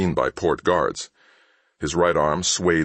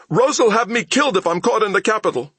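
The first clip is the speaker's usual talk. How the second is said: high, louder, fast frightened plea; frightened begging; 4 dB louder, faster than usual. A narrator's reading. Thief begging Mal, high fast and frightened. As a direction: rush it out in fear.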